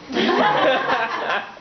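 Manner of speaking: short amused chortle. Acted. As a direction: chortle at him. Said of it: "Spire chortled".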